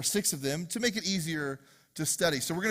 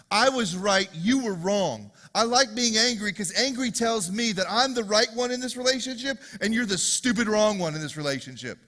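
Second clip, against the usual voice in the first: loud, raised, self-righteous indignation; full-force preaching; 6 semitones higher, 6 dB louder than usual. Acted. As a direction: preach it hard as mock righteous anger.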